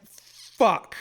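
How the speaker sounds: loud, high string of curses; angry cursing. Retold or imitated. After losing his toasty, angry cursing. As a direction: spit out the swears in frustration. Swearing at ruined meal.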